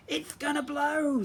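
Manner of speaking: high, slow, wailing warning. Acted. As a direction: moan in alarm that it will blow. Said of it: "the Molar sisters wailed".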